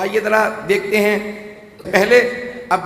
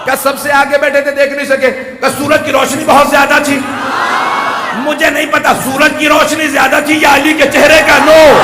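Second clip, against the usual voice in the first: high, loud ecstatic cry; fervent shout; 5 semitones higher, 6 dB louder than usual. Real, not acted. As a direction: lift it to rapture. Rising fervent climax. (علی کے نور پر وجد میں بلند پکار)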